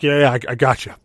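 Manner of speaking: lightly voiced drunk, a little loud; a touch of tipsy bravado; drunk brother. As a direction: imitate a drunk pal agreeing. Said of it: "of course, he's drunk".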